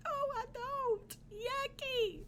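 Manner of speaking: very high playful squeal; mock disgust. Answering the broccoli ice cream question, mock-disgusted squeal. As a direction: squeak out a pretend refusal. Disgusted punchline of children's song.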